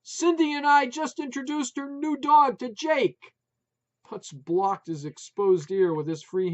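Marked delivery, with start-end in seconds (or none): 0.0-3.3 high, loud shout. A narrator's reading. Wife shouting news about the new dog, loud. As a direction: yell it over the dogs. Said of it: "Maureen shouted".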